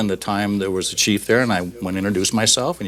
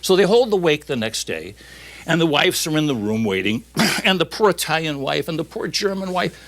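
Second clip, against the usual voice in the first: ordinary delivery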